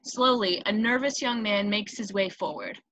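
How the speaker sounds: normal talking voice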